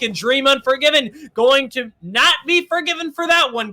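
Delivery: ordinary delivery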